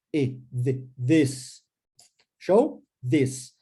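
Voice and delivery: ordinary talk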